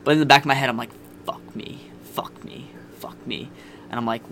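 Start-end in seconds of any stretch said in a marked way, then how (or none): 1.2-3.5 hushed, half-whispered fuck me; whispered self-curse, repeated; panicked inner thought. Retold or imitated. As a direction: mutter it under your breath in dread. Inner panic while meeting girlfriend.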